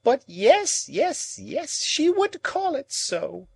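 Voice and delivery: put-on high, slow bitter old woman's voice; bitter Miss Havisham. A narrator's reading. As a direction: spit it out in bitter anguish. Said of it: "exclaimed Miss Havisham".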